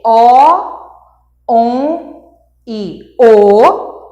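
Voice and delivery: "o", nasal "on", "o", each loud and drawn out; sons vocálicos. Shown as a demonstration. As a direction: demonstrate "o", "on", "o" slowly and emphatically. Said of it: "são doze sons vocálicos produzidos". ("o, on, o" bem prolongados e fortes)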